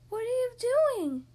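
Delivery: high, slow curious little pig. Acted. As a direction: ask it wide-eyed, drawn out. Piggy voice.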